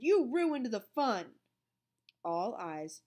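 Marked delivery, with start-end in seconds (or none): 0.0-1.4 loud, slow, higher angry shout; boy yelling at Melvin. A narrator's reading. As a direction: accuse him hard. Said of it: "he shouted".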